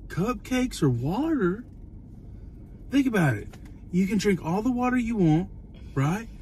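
high, animated rant; excited high rant. Real, not acted. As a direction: build it up excitedly. Excited rant about food.

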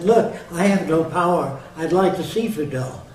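loud insistent. Retold or imitated. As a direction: press the point hard. His insistent reply, acted quote.

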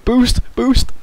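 loud urgent call. Real, not acted. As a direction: shout it to a teammate. Calling out in game.

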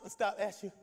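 ordinary delivery

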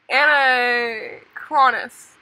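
anachronis- sounded out syllable by syllable, very slow; sounding it out slowly. Shown as a demonstration. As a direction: drag out anachronis- as if spelling it.

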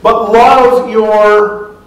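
slow, solemn scripture reading; drawn-out, loud quote of Jesus; Jesus' words. Acted. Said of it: "Jesus says".

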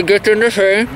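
ordinary talk, nothing marked

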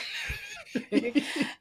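a long, high, fading laugh; real falsetto laughter. Real, not acted. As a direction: crack up at the joke. Laughing at co-host.

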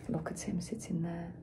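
put-on hushed conspiratorial whisper to the children. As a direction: lean in and whisper the aside. Storyteller's hushed aside to listeners.

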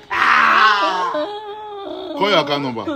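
hammed-up crying plea; drawn-out mock plea. Prankster wailing to his crying girlfriend. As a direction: stretch it out slow in a pleading wail.

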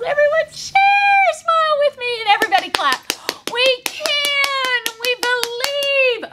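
high-pitched sing-out chant; rallying cheer. Pretend. Storyteller leads children in clapping, cheering.

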